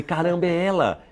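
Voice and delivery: high-pitched, drawn-out amazement; retold amazed recognition. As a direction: relive the thrill, stretching it out high. Retelling the moment he recognized her.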